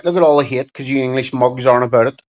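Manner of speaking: ordinary talk, nothing marked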